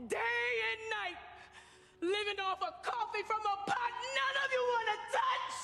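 furious, rising into a shout; angry shouting. Acted. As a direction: shout it at the boss in anger. Maid confronts her boss.

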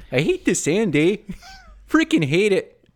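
high, loud hammed-up Canadian accent; mock Canadian accent. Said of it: "an actual Canadian accent".

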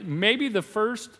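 ordinary delivery

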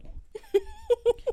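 high burst of laughter. Real, not acted. Laughs at own jab.

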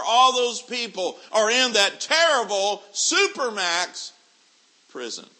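high, loud hellfire sermon voice; full-force preacher warning of hell; fiery preaching. Acted. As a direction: thunder it down from the pulpit.

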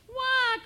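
loud, high acted baby cry; high mock-crying "wah"; baby's wail. A narrator's reading. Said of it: "cried baby".

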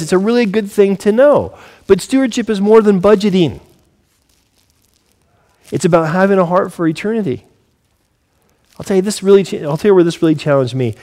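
normal talking voice

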